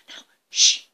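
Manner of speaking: whispered hush to keep hidden; hushed shh. Acted. Mouse hushing friend in house.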